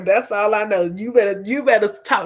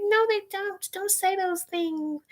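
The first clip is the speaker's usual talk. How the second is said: squeaky high "no they don't" protest; high mock-indignant denial; high disbelieving denial; 11 semitones higher, 8 dB quieter than usual. High squeaky denial to people spreading rumors.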